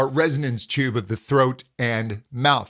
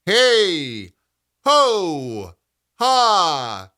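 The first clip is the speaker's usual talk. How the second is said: louder short resonant calls; vertical resonance calls; 3 semitones higher, 4 dB louder, much slower than usual. Shown as a demonstration. Coach shows vertical resonance, louder calls. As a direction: punch out hey, ho, ha. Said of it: "this vertical feeling".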